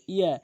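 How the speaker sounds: short ইয়া with single y; ইয়া cut short, no stretch; common mispronunciation. Shown as a demonstration. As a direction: say ইয়া quickly, undoubled. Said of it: "অধিকাংশ লোক ইয়া বলে".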